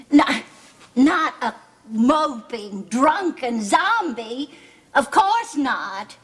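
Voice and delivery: ordinary talk, nothing marked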